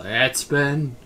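drawn-out mock battle cry; mock yell. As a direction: call it out slowly like a catchphrase. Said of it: "I just had him yell".

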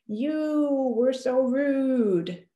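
hammed-up, slow blaming complaint; mock accusatory. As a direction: point the finger, stretching every word. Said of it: "the accusatory ones".

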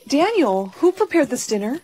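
delighted surprise. Acted. Wife discovers a romantic dinner, delightedly surprised. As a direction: call out, pleasantly amazed.

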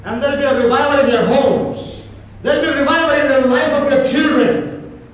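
loud, rising sermon climax; preaching at full force. Acted. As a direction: drive it home to the congregation.

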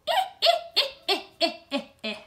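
high, loud cackling eh; witch cackle. Shown as a demonstration. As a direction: cackle like a witch scratching an itch. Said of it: "sounds like you're cackling if you do it just right".

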